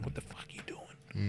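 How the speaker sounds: hushed, breathless what are you doing; whispered disbelief. Retold or imitated. Teammates watching the fight, whispering in shock.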